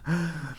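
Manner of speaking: quiet, short laugh; soft chuckle. Real, not acted. Streamer after a grim purge joke, soft chuckle. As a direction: chuckle softly.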